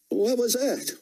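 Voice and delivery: high startled soldier voice; a soldier blurts out at the screen; surprised soldier. A narrator's reading.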